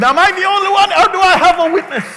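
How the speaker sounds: high, loud preacher's shout; full-force preaching. Acted. Pastor asks congregation for a witness, fervent loud preaching. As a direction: preach it hard, pitched well up.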